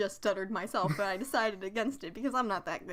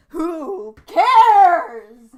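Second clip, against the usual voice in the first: loud retort; 6 semitones higher, 16 dB louder than usual. Real, not acted. Retort to the quote.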